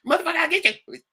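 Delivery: high, loud mock threat; hammed-up gangster shout; voicing gangbangers. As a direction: yell it like a punk. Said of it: "gangbangers are all like".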